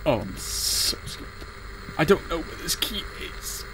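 hushed frightened whisper; scared whisper. Real, not acted. Gamer whispering scared to viewers while searching. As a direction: breathe it out, too scared to speak up. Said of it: "Guys, I'm scared".

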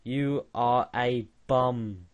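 ordinary talk, nothing marked

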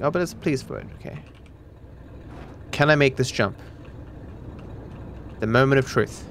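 ordinary talk, nothing marked

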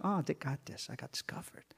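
hushed conspiratorial whisper; whispering mom. Retold or imitated. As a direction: breathe it like a scheming mother. Mom reassuring son in story.

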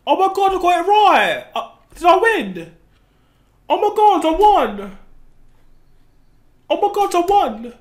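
thrilled and breathless, voice jumping up; can't believe you just won; excited disbelief. Real, not acted. Player realizes they won the game, excited shout.

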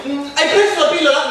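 high, loud fussing mum with ehm; flustered mother. Acted. Mother voiced. (mamma agitata, acuta e forte)

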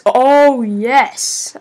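delighted cry at a lucky find; pleased surprise. Real, not acted. Gamer spotting another possessed hatchet mid-review, pleasantly surprised.

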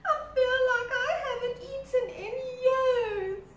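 high, loud mock crying crocodile; hammed-up wailing. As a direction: whine it through fake sobs. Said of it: "wailed Crocodile".

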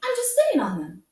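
high, loud protest; an indignant little rabbit cries out high and loud; crying out. A narrator's reading. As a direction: yelp it out in defence. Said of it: "cried the little rabbit".

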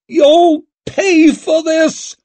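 slow, loud, very high scream; screaming threat. A narrator's reading. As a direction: scream vengeance at them all. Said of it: "Lady Wetterland was screaming".

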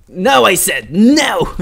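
slow, loud, mock-commanding shout; yelled refusal. Real, not acted. Fleeing enemy.

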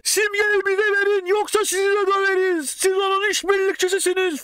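high-pitched threatening shout; retold shouting. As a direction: yell threats through the door. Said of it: "falan diye bağırıyoruz".

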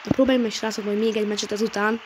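ordinary delivery

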